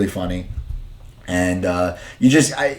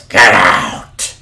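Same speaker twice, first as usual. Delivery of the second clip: loud, drawn-out yell; Vince shouting; 7 dB louder than usual. Retold or imitated. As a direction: bellow it to throw them out. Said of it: "and then he goes".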